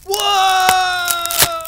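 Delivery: very high, loud whoa; startled yell. Acted. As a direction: yell as you fall. Falls from tree.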